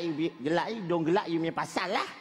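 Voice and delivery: usual speaking voice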